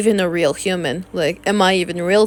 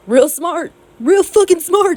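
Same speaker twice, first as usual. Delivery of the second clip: mocking, rising higher and louder; sarcastic; 9 semitones higher, 4 dB louder than usual. Real, not acted. Sneering sarcasm aimed at the harasser. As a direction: spit out the sarcasm.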